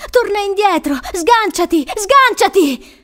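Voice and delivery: high, loud commands, last one drawn out; desperate repeated shouts; yelling. A narrator's reading. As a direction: yell urgently to break someone free. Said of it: "urlò Crystal".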